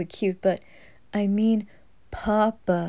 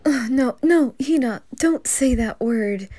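higher, louder flustered protest; flustered dad pleading no; mock panic; 4 semitones higher, 4 dB louder than usual. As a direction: panic at the baby's rude word. Dad panics at bad word.

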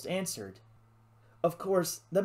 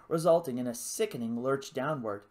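usual speaking voice

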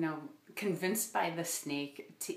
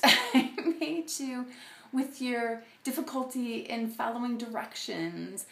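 amused loving God; 4 semitones higher, 5 dB louder than usual. Retold or imitated. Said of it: "what if instead he was saying".

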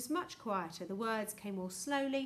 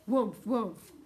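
loud booming "woomph"; imitated explosions; 8 dB louder than usual. A narrator's reading. General enjoying the lovely noise, imitated blasts. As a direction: mimic bombs going off with relish.

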